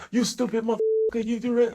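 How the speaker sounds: imitating Warren. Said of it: "and that's how Warren talked".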